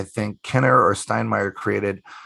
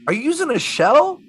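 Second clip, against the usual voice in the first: high-pitched retold question, rising; imitating an astonished caller; amazed question; 14 semitones higher, 4 dB louder than usual. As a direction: ask it high, as if baffled by a trick.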